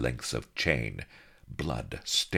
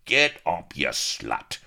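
shouted; 3 semitones higher, 6 dB louder, slower than usual. A narrator's reading. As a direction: snarl it down at her. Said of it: "whereon he shouted".

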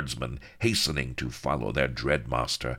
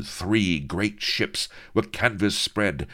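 usual speaking voice

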